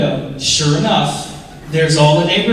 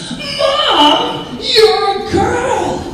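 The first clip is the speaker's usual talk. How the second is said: put-on high, loud child's squeal, laughing; drawn-out, high kid voice through laughter; little son's amazed cry; 6 dB louder, much slower than usual. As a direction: squeal it like a stunned little boy seeing mom dressed up.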